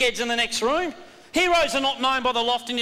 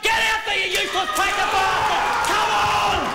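yelling; 8 semitones higher, slower than usual. Real, not acted. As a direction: roar them out the door. Coach sending team out, yelling.

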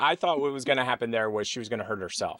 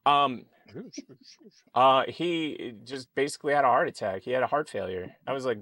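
usual speaking voice

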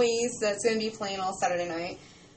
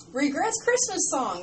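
ordinary delivery

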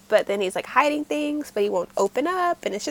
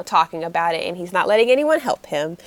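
ordinary delivery

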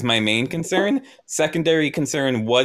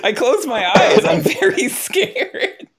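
laughing; 11 semitones higher, 4 dB louder than usual. Real, not acted. Player laughing while admitting fear.

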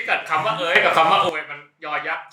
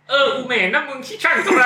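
mock theatrical classical Thai, a little higher; reading an old literary insult; 8 semitones higher, 3 dB louder than usual. Reading a literary insult to friends, hammed-up classical scolding. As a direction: ham up an old-fashioned scolding line. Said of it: "เดี๋ยวผมจะอ่านคำด่าจากวรรณคดีให้".

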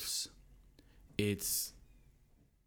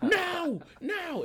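very high, loud repeated cries; hammed-up high-pitched protest; mock yelling; 6 dB louder than usual. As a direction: imitate a tackled man yelling in panic. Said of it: "just yelling".

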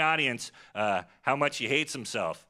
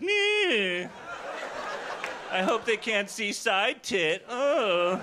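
put-on girly squeal; 6 semitones higher, much slower than usual. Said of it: "I'm like".

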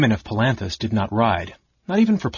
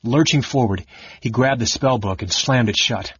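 ordinary delivery, nothing marked

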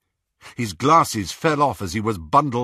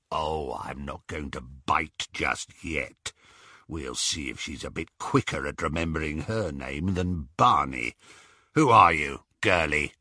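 gloating werewolf; 5 semitones lower than usual. Acted. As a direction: savour every word like prey. Greyback menaces Hermione.

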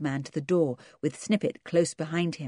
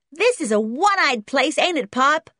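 put-on high, loud kid's voice; brash American boy; 10 semitones higher, 6 dB louder than usual. A narrator's reading. Boy to his Pop about the place, brash and loud. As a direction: complain loudly like a cocky American boy.

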